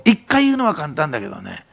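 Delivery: usual speaking voice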